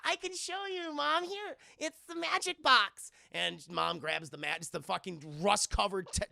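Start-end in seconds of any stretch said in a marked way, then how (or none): 0.1-3.1 put-on high child's voice; imitating a daughter showing mom the box; little girl voice. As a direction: offer it like an eager kid. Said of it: "She goes".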